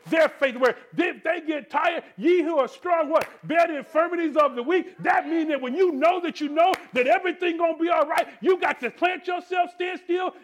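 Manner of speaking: loud, rising shouted refrain; preaching at full force. Real, not acted. Preacher rousing the church to serve others, shouting. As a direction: drive each line home to the congregation.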